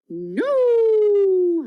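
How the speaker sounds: high, loud howling no; howled. A narrator's reading. Bill howls his denial at the cowboy, high and loud. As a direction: howl it like a coyote. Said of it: "howled Bill".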